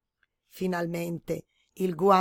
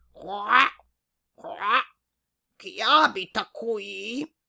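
croaking frog; 2 semitones higher, 4 dB louder, much slower than usual. A narrator's reading. Frog asking who lives in the glove, slow and croaky. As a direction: quack out the question like a curious frog.

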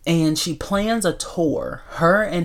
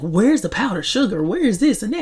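higher, sing-song acted complaint; mock diva demanding; 7 semitones higher than usual. Diva quizzing the man about her French toast, mock demanding. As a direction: fire off questions like a fussy diva.

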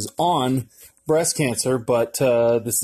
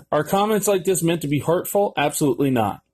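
normal talking voice

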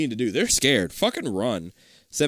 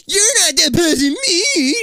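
high, slow crying yell; 7 dB louder, slower than usual. Acted. Kid brother wailing defiance at his sibling, high and loud. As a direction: wail it like a bratty kid. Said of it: "Denny yells".

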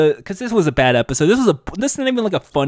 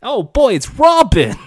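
high, loud starstruck shout; excited fan; 8 semitones higher, 4 dB louder, slower than usual. Pretend. Fan spotting Robin, shouting with excitement. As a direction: gush over the hero like a fan.

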